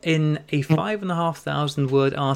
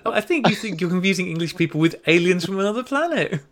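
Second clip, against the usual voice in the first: higher, laughing disbelief; amused teasing; 6 semitones higher than usual. Real, not acted. Host teases Mike over his alien English accent, laughing. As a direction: rib him through laughter.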